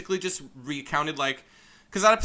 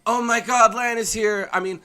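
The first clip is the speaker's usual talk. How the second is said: higher, louder hurt schoolgirl voice; upset classmate; 6 semitones higher, 7 dB louder than usual. Acted. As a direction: demand answers from a friend who left.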